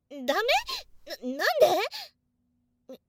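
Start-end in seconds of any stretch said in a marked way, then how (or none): 0.1-1.7 loud, high stammered why; flustered protest. Real, not acted. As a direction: blurt it out, caught off guard.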